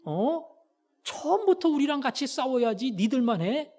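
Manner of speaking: acted-out accusing protest; confrontational complaint. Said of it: "기드온 불러가지고 따지는 겁니다".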